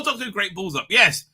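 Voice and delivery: normal talking voice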